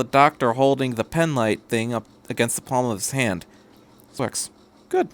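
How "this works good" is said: softer, to himself; murmur. A narrator's reading. Doctor murmuring to himself over his pen light, softer. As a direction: mutter it under your breath. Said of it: "he heard the doctor murmur".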